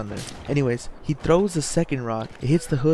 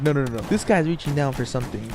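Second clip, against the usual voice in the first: ordinary delivery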